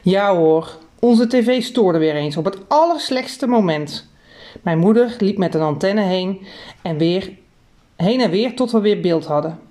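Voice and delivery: ordinary delivery, nothing marked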